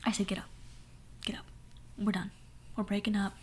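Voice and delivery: normal talking voice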